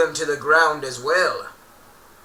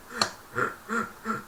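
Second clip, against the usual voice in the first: gasp; 10 semitones higher, 6 dB quieter than usual. Acted. Hurt reaction.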